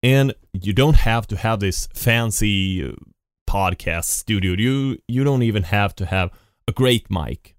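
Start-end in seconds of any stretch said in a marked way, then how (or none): none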